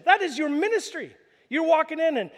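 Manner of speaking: ordinary talk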